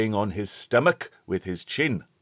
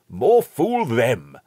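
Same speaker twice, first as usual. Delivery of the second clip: louder, slow rude retort; a cheeky boy sneering back; 5 semitones higher, 8 dB louder, slower than usual. A narrator's reading. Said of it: "at once rudely".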